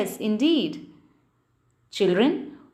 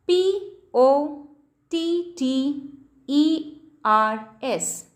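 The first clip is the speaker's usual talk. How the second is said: slow, letter-by-letter spelling; measured classroom dictation of each letter; slower than usual. Shown as a demonstration. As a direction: spell it out slowly for children, pausing between letters. Teacher spells word to underline.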